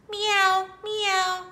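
high, loud mewing; cat meow. Acted. Reading a farm book, the cat meows high.

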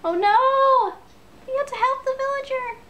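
high panicked pleading for the villager; panic, begging it to live. Real, not acted. Villager about to be killed.

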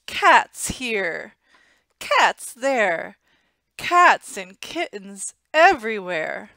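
loud, slow, rising alarm over the cats; crying out. Acted. Old woman scolding husband about endless cats, loud and slow. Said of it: "she cried".

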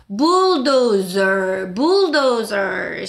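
bulldozer very slow with a curled r sound; rolled bulldozer. Shown as a demonstration. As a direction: roll through bulldozer slowly. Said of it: "거의 다 굴려요".

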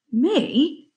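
high, startled little question; gulped. A narrator's reading. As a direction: squeak it out with a nervous gulp. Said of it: "gulped Mungo".

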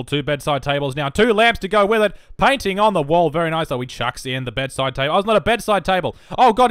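loud, high, excited play-by-play; sports commentator. Shown as a demonstration. Commentator calling furniture going in, loud excited play-by-play. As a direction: call the room-building like a live match. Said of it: "I can do a commentator's voice if you like".

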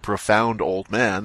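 usual speaking voice

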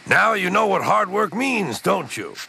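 amused lecture, high then dropping on the tag question; Gordon. A narrator's reading. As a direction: rub it in to a beaten rival. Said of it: "chuckled Gordon".

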